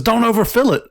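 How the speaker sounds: nagging coworker. Retold or imitated. Pharmacy coworker correcting the helper, high mimicking voice. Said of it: "when homegirl turns around and says".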